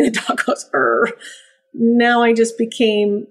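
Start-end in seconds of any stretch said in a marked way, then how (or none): 0.7-1.1 low mock growl; imitated dog growl. Dog answering trainer's "trust me", imitated growl. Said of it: "what if the dog does growl".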